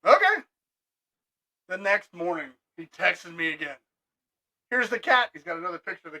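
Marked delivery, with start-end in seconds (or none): 0.0-0.3 higher and louder mock okay; mocking the customer. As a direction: imitate his curt reply, hammed-up. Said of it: "He's like".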